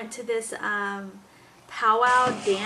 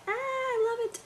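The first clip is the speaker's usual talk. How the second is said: put-on very high, happy baby squeal; imitated delighted baby; 12 semitones higher, 4 dB quieter than usual. Mom voicing her baby being talked to, high delight. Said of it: "Every time you talk to her, she's like".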